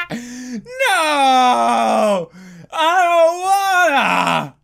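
high wailing cry; panicked refusal. Real, not acted. Player refusing to turn around, panicked wail. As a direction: wail it loud and drawn out.

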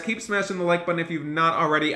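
normal talking voice

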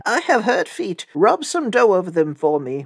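high, put-on wolf voice; wolf's softened voice. A narrator's reading. Wolf with softened voice asks baker.

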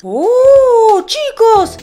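long, high "oh" of wonder; thrilled discovery; amazed excitement. Real, not acted. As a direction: exclaim high and drawn out to viewers. Discovers exclusive armor.

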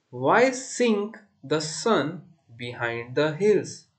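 measured verse, pausing between phrases; poem recitation. Shown as a demonstration. Teacher reciting for students to repeat, measured recitation.

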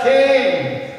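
high, loud preacher's cry of King!; called-out cry. Acted. Preacher voicing a desperate woman calling, loud.

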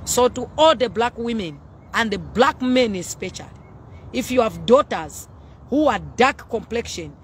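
normal talking voice

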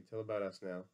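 ordinary delivery

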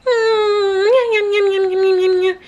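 high playful munching noises; childlike nom-nom eating sounds. Acted. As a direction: smack the lips like a little kid eating.

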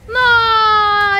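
loud, drawn-out cry of dismay; dismayed wail. Real, not acted. Reacts to being caught.

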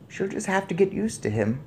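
put-on high, composed old lady; unruffled grandmother. A narrator's reading. Grandmother answers yelling father, unruffled. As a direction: dismiss his worry evenly.

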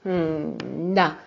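hesitant hum before agreeing; stalling, reluctant yes; throat-clearing hesitation. A narrator's reading. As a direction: clear the throat, then concede slowly. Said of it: "își drese glasul".

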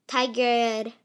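ordinary talk, nothing marked

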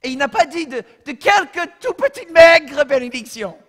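high and loud, hammering the words home; mock the idea of tiny blessings with force; loud insistent preacher; emphatic preaching. Preacher pressing the point.

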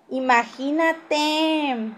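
mock amazement. Real, not acted. Mocking drawn-out exclamation.

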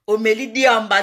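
ordinary delivery

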